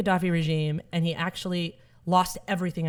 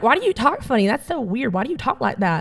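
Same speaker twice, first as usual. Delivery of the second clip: higher, lilting schoolkid teasing; mock kid voice; 3 semitones higher than usual. Schoolkids asking the new girl why she talks funny, mocking kid voice. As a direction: swing the pitch like puzzled kids. Kids questioning her speech.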